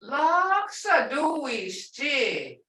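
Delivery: laksa duishchi. said slowly, high, falling tone. Shown as a demonstration. Teacher slowly shows the liar tone. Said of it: "I say".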